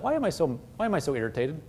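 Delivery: quiet self-questioning. Shown as a demonstration. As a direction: ask yourself softly, almost to yourself. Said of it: "ask yourself".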